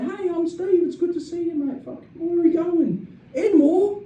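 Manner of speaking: high-pitched, eager welcome; excited driver. Retold or imitated. New driver greeting his passenger, excited. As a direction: greet the passenger with chirpy enthusiasm. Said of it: "'cause it started".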